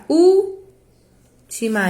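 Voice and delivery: "última" with a high ÚL, pause, then weak "tima"; stress on first syllable. Shown as a demonstration. Teacher reading the lyric, marking ÚL in última. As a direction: hold off after a strong ÚL in última. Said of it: "você fala uma fortona".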